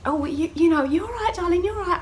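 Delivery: high, sing-song imitation of reassuring nurses; soothing staff. Maternity staff to woman in labour, high soothing coo. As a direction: coo at a patient in a put-on high voice.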